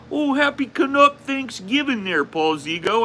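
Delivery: high-pitched caricature of a cheery Canadian; hammed-up Canadian greeting, way up high; mock Canadian accent. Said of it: "three days straight, people".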